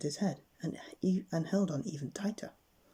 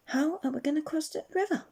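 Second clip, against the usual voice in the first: higher anxious Jack voice; worried Jack; 13 semitones higher than usual. Acted. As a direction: pitch up, fretting about the river.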